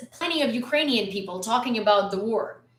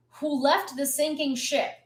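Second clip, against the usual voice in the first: ordinary talk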